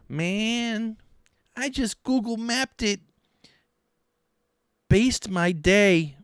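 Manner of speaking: high, drawn-out mimicking voice; Hugh Giordano. Vlogger imitates Hugh, high mocking impression. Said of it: "Okay, here's Hugh".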